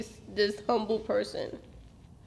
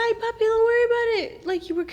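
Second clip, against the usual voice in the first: higher, bright upbeat reassurance; cheerful reassurance; 8 semitones higher, 4 dB louder than usual. Retold or imitated. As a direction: sound cheerful to keep his hopes up. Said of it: "I said".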